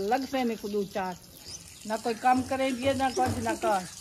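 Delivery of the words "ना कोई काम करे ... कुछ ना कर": ordinary talk, nothing marked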